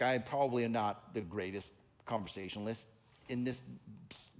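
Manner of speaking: normal talking voice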